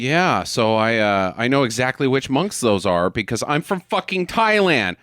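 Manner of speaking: normal talking voice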